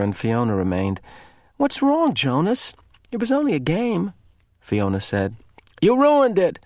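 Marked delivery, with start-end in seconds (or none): 1.6-4.1 high, concerned question; worried girl. A narrator's reading. Fiona worried for Jonas. 5.8-6.6 high, loud irritated snap. A narrator's reading. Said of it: "in an irritated voice".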